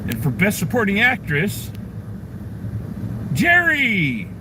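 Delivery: high announcer build to a drawn-out name; hammed-up award announcement, pitch soaring on the winner; mock awards presenter. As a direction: play the awards host, stretching the name high.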